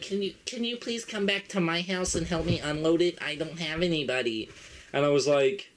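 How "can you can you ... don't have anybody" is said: higher, put-on elderly voice; imitated granny, trailing softer at the end; sweet old lady. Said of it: "she's like".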